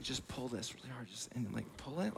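hushed, tight request through pain; quiet strained plea. Retold or imitated. Injured friend asks you to pull his shoulder, hushed. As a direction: ask quietly as if hurting. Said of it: "then he's like".

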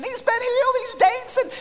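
high, loud holler; screaming proclamation. Retold or imitated. Group ushering him to the door, screaming. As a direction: keep screaming it like an excited prayer group. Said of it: "ushered us towards the door and screaming".

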